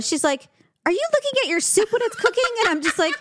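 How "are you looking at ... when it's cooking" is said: high, fast disbelieving question; mock incredulous friend. Friend quizzing her about the soup, high and fast.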